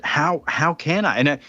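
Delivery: ordinary delivery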